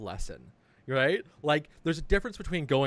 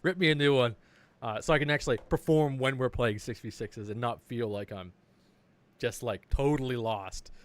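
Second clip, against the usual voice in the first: ordinary talk, nothing marked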